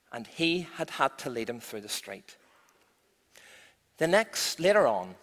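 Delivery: ordinary delivery, nothing marked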